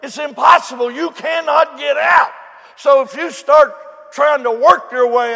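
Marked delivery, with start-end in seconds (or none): none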